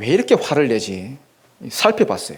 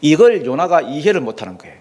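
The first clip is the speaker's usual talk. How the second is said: usual speaking voice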